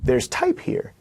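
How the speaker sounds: high, startled discovery; a sudden oh of noticing; mock surprise at the page; surprised reader. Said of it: "makes you go".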